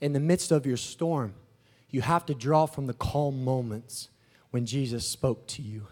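low, soft, measured sermon voice; hushed preaching. Real, not acted. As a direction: draw listeners into the calm. Preaches about calm moments.

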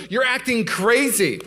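slow, high, drawn-out accusation; escalating wife imitation. Wife taking the argument to the next level, imitated slow accusation. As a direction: stretch it out high, as the final provocation. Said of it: "this is where you go to the next level".

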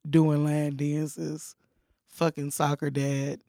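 low, quiet throwaway jab; no emphasis. Real, not acted. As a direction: toss the joke off softly, just talking. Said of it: "say it with emphasis".

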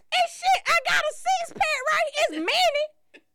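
mock guest bragging. Guest shows off his mini Coke cans, high loud put-on voice. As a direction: brag about the tiny six pack in a squeaky voice. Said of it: "I bought a six pack of Coke".

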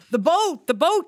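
high, loud alarmed call; crying out. Acted. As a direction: shout the warning across the deck. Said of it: "cried Starbuck".

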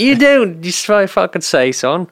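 put-on botched Australian accent sliding Irish; slipping Australian-Irish accent. Teasing his friend in a wandering mock Australian-Irish accent. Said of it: "I went Irish there at the end".

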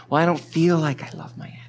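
fast, whispered grumble of a reluctant believer; whispered excuse. Retold or imitated. Believer excuses not loving his enemy, whispered and quick.

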